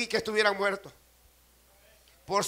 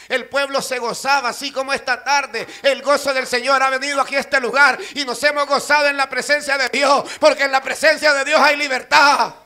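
loud, rising exultant sermon; full-force preaching; 3 semitones higher, 11 dB louder than usual. Acted. Preacher celebrating freedom in God's presence, full-force preaching. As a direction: build in volume toward a ringing finish.

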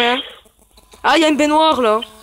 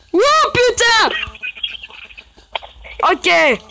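scared shout; 9 semitones higher, much slower than usual. Real, not acted. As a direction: shout while fleeing in fright. Fleeing the monster upstairs. (cris de panique en fuyant)

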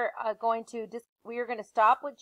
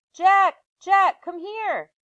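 high, loud call from far off; 9 semitones higher, 10 dB louder, much slower than usual. Acted. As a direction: yell for him to come over. Annie calls from the woods.

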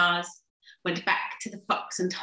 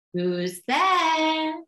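troll voice; 6 semitones higher than usual. Acted. Trolls call out.

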